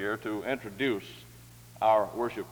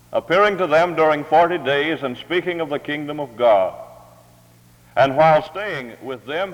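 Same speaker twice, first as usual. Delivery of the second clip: loud, projected Bible reading; formal scripture reading; 2 semitones higher, 8 dB louder than usual. Real, not acted. Reading Acts to the morning congregation, loud formal proclamation. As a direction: proclaim the scripture in full, measured phrases.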